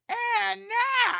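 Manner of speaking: loud, very high overblown announcer call; mock broadcaster voice. Host mocking people who put on broadcaster voice, overblown. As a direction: belt it out like a hammy announcer. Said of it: "call something like".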